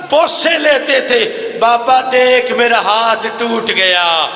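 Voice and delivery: grieving daughter keening at her father's grave; wailing lament. A narrator's reading. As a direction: cry it out as the broken-handed daughter.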